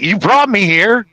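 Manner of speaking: high, slow, incredulous complaint; drawn out in disbelief; exasperated. Retold or imitated. To the friend who brought him there, high slow disbelief.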